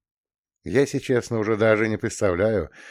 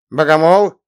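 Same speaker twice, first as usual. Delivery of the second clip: loud high surprised question; 8 semitones higher, 11 dB louder than usual. Acted. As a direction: ask back in disbelief. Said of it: "переспросил удивлённо Прилизанный".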